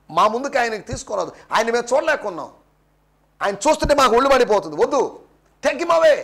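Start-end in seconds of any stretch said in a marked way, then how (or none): 4.8-6.1 higher-pitched refusal, half-shouted; retold crowd yell. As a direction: cry out in protest as the crowd. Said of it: "వాళ్ళు అరుపులు ఏంటంటే".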